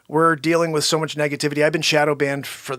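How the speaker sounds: ordinary talk, nothing marked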